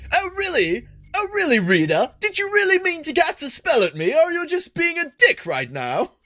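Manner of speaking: high-pitched, indignant British rant; hammed-up British TV host in a huff; John Oliver. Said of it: "starting to sound like John Oliver".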